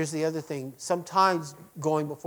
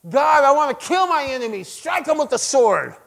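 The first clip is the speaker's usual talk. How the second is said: angry prayer; 8 semitones higher, 7 dB louder than usual. Retold or imitated. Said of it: "prayers that said".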